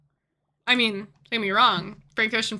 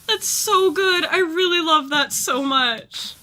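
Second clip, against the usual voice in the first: teary; 6 semitones higher, 4 dB louder, slower than usual. Real, not acted. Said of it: "I'm very emotional".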